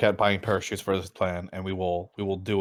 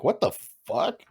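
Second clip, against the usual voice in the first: retold disbelief; 12 semitones higher, slower than usual. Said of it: "I was like".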